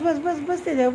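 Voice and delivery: ordinary talk, nothing marked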